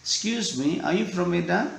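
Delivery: Medan question with the voice rising; rising tune. Shown as a demonstration. Teacher showing students a rising question. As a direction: lift the end of "are you from Medan?". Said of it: "Naik ya nadanya".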